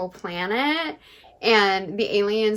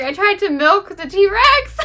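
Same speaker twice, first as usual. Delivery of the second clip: high, loud silly confession; sheepish intern; 11 semitones higher, 9 dB louder than usual. Retold or imitated. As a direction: confess it like a guilty, clueless kid. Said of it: "he's like".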